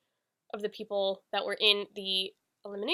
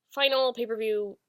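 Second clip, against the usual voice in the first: ordinary delivery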